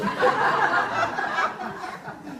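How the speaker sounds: airy high-pitched laughter; high wheezing laugh. Real, not acted. Veteran laughing hard at his thin pants joke, high and airy. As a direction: let the laugh run away from you.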